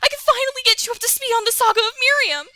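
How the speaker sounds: fast, high worried chatter; high girly Miriam voice. Acted. Streamer voicing Miriam fretting over the player. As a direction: gush with worry.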